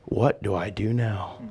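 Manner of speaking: low, slow, hushed aside; at a loss. Retold or imitated. Pastor brought a hard problem, quietly at a loss. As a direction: voice a stumped pastor under the breath.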